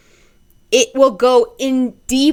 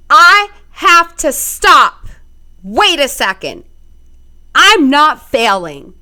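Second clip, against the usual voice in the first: louder, slower, firm self-talk; forceful self-command; 4 semitones higher, 6 dB louder than usual. Shown as a demonstration. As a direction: say it as hard as you can to yourself. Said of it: "You say it as hard as you can".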